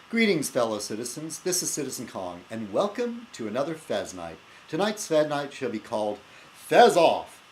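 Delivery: grand host greeting, title punched loud; show host intro. Acted. Host restarting his show opener, title belted out. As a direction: open the show with a flourish, slam the title.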